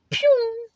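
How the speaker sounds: high-pitched "pew"; high vocal zip for a quick exit; imitated whoosh. Imitated noise of shooting off.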